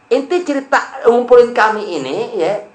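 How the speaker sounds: high, scornful, drawn-out sneer; mocking Abu Lahab. Abu Lahab mocking Muhammad before the Quraysh, high and scornful. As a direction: jeer at the Prophet's story as a fairy tale.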